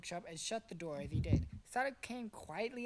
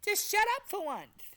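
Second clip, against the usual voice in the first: angry snap; 12 semitones higher, 8 dB louder than usual. Acted. Zooey snapping at Tails.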